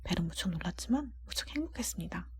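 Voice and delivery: usual speaking voice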